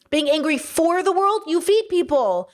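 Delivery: ordinary talk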